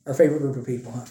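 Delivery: dry sarcastic aside. Acted. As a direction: say it with a sarcastic edge. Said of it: "I say that sarcastically".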